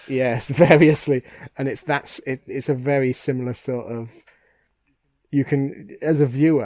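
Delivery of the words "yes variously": laughing. Real, not acted. Laughing at the word.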